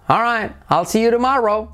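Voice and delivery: "I'll see you tomorrow" high and lilting; high, tuneful goodbye on "see you tomorrow"; sing-song intonation. Shown as a demonstration. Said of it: "son como muy cantarines".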